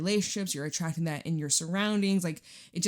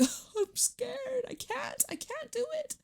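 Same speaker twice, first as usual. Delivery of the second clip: high, slow, whiny scaredy-cat; teasing the listener with a tiny scared voice; mock scared voice; 4 dB quieter, slower than usual. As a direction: squeak it like a frightened little kid. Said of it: "You're like".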